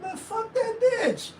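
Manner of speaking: high, light, breezy brush-off; a careless toss-away line; offhand dismissal. Shown as a demonstration. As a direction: shrug it off lightly, no venom. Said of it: "You don't mean, like".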